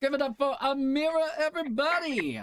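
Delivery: host rallies applause for Amira; hype host call. Real, not acted.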